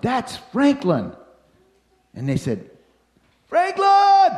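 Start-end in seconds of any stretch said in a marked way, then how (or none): 0.0-1.1 high, drawn-out call of recognition; retold holler. Hiker spots Franklin among the tents, retold holler. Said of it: "somebody hollered". 3.5-4.3 very high, loud long-distance call; hollering. Retold or imitated. Hikers call to a tiny figure below, hollering. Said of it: "And we all hollered".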